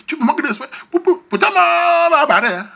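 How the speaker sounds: high, loud imitation of the furious girl; angry woman. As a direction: shriek it at him like she's cornered him. La fille furieuse l'insulte. (imitation d'une fille furieuse, aiguë et forte)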